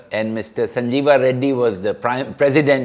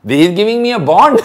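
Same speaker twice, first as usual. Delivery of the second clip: laughing while protesting; 5 semitones higher, 7 dB louder than usual. Retold or imitated. Laughing while retelling the stage protest.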